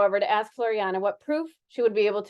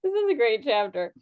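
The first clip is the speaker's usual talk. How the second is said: usual speaking voice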